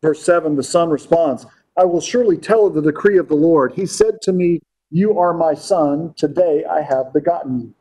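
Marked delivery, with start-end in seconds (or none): 1.8-7.7 raised, quicker reading voice; preacher reading the psalm aloud to the congregation; scripture reading. Acted.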